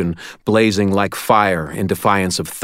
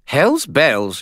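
higher put-on spirit voice; Bob's voice; 7 semitones higher than usual. A narrator's reading. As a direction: voice the cheeky skull spirit.

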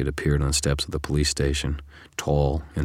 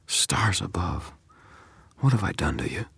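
hushed whisper; 4 semitones higher than usual. A narrator's reading. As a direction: breathe it out, barely voiced. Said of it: "I said quietly".

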